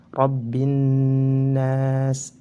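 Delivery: "rabbin nas" with a long hummed n and no l; recited "rabbin nas", doubled n, long final vowel; silent lam. Shown as a demonstration. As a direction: merge into the n of "rabbin nas" and hold it. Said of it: "Lamnya tidak terbaca".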